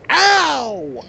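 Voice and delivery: high, drawn-out "ow" as if hit; mock yelp of pain. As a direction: imitate someone yelping from a sudden blow. Acting out being hit by chair.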